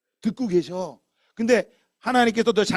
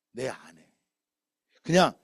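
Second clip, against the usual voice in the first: ordinary talk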